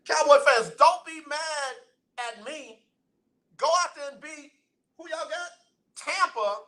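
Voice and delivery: rally the fans like a sermon; radio host preaching to Cowboy fans to stop being mad. Real, not acted. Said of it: "Boy, I'm preaching".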